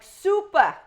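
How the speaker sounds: loud, high mock building super; calling out as the building super; imitating her own voice. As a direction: pretend to be the super at the door. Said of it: "in my voice".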